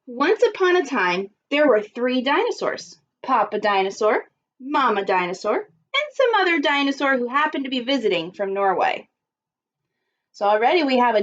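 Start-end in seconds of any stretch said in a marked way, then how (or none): none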